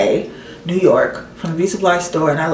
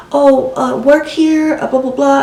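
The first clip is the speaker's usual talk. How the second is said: high, louder broken-English mimicry; imitating halting English speaker; 8 semitones higher, 5 dB louder than usual. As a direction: put on a higher voice, fumbling for English. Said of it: "She can barely speak any English".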